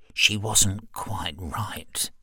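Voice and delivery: slow hushed whisper. Acted. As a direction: whisper it like a secret. Confiding about the sister.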